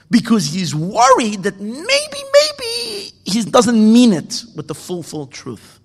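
slow tearful concern; worried, near tears. A narrator's reading. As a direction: let the worry break through. Rebbe's worry about sincerity.

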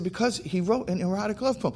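high-pitched retold back-and-forth reply; insistent answering. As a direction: answer back, stuck in the same argument. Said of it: "we're going back and back and back and forth".

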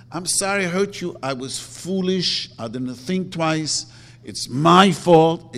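heartfelt apology. Shown as a demonstration.

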